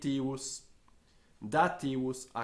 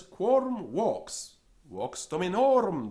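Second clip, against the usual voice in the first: raised, slowed question and answer; high slow question; 6 semitones higher, 7 dB louder than usual. Acted. Teacher reviewing the story voices for his class, raised and slow. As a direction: voice it again slowly.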